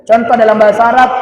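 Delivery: ordinary talk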